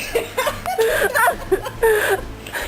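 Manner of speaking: laughing. Real, not acted. Cast bursts out laughing.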